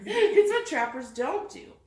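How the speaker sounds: amused correction through a laugh. Real, not acted. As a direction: spill a real laugh into the words. Laughing while correcting the reader.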